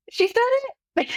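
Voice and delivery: high, incredulous emphasis; mock incredulous. Mother telling the principal her daughter just said it, high mock disbelief. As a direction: stress the absurdity.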